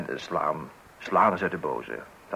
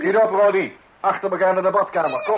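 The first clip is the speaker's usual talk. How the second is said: loud, high command; march him off to the bath; stern order; 11 semitones higher, 7 dB louder than usual. Acted. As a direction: parent ordering Roddy to the bathroom, loud and stern. Parent orders the child to the bathroom.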